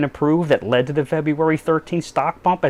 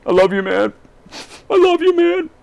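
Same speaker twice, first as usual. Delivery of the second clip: rising into a squeaky, higher declaration; mock gushing; 3 dB louder than usual. Trump gushing love to Putin, going up into a squeak. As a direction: pitch it higher each time, gushing. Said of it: "I feel awkward saying this".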